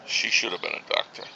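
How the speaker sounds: low, soft parental disappointment; quiet regret. Acted. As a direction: say it under your breath with regret. Father regretting daughter's career.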